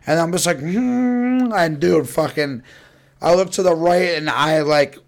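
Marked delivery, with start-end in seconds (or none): 0.6-1.5 high hum. Retold or imitated. Humming while driving tense.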